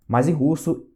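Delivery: ordinary talk, nothing marked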